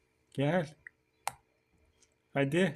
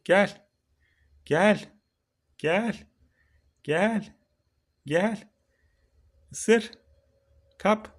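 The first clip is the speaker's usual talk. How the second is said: high, louder coaxing calls; 10 semitones higher, 6 dB louder, much slower than usual. Real, not acted. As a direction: call a pet over in a much higher, eager voice. Calling the turtle to the carrot.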